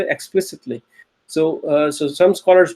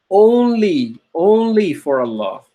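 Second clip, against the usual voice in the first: "only" dicho más alto y marcado; stress on only; slower than usual. Shown as a demonstration. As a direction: golpea la palabra "only". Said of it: "the aspect of only comes".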